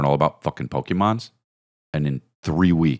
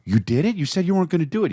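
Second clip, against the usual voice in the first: put-on much higher, thrilled kid voice; excited child; 13 semitones higher, much faster than usual. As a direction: squeal it like a delighted boy. Child thrilled at taped show.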